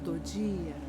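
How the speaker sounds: slow meditation voice with long pauses; unhurried, calming relaxation guide; guided sleep meditation. Acted. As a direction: lead the listener gently into sleep, phrase by phrase.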